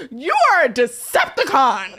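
put-on high, loud, hurt little-boy voice; hurt toddler insult. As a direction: deliver the insult with wounded passion. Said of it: "said it with so much passion and hurt".

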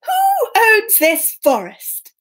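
high, slow, grand king; drawn-out royal inquiry; storybook king. Acted. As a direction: call out regally, stretching each word. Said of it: "and the king did the same".

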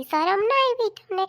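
wife shaming her husband, slow and loud; indignant scolding. Acted. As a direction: drag it out, shaming him.

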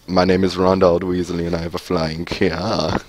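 put-on silly British accent on one level run; hammed-up British introduction; fake British accent. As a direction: do a goofy mock British voice. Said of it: "his British accent, his fake British accent thing".

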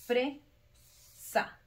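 "fresa" slowly split, FRE, pause, SA; split into syllables. Shown as a demonstration. Teacher counting two syllables for pupils, "fresa" split FRE, SA. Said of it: "¿cuántas sílabas tiene? Tiene dos".

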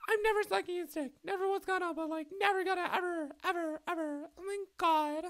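put-on very high, far-off girly voice; mock valley girl.

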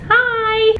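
1 excited hi. Real, not acted. Excited greeting to camera.

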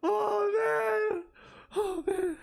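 high, whimpered cry after a fright; real high-pitched distress; shaken wail. Real, not acted. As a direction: wail as the scare sinks in. Reeling from scare.

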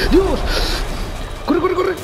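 high, frantic cries while fleeing; panicked yelling. Real, not acted. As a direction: yell it high, running for your life. Chased by monsters, fleeing. (gritos de pánico muy agudos)

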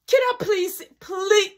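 very high-pitched mock pleading; squeaked-out, hammed-up begging; desperate begging. Mother pleading to see report.

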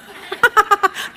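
high burst of real laughter; laughing at own joke. Real, not acted. Preacher laughing at his own joke to the congregation, real laugh. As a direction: laugh out loud at the punchline.

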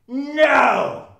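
high, drawn-out loud no; emphatic denial. Acted. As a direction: knock the idea down loudly. Emphatic answer to own rhetorical question.

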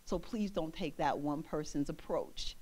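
low, soft, sincere aside; gentle reassurance. Retold or imitated. Turning to reassure someone put off, gentle and low. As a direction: quietly reassure them, voice low.

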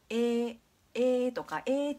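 "え" held long, like ee in Japanese; lengthened e. Shown as a demonstration. Teacher contrasting with ei, "え" stretched out. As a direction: draw "え" out as one long vowel, no i. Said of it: "っていう伸びる音".

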